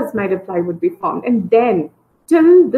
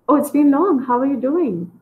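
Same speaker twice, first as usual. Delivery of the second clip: surprised reunion; 5 semitones higher than usual. Shown as a demonstration. Teacher demos greeting an old friend, pleasantly surprised. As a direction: sound glad it's been so long. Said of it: "the genuinity of the question".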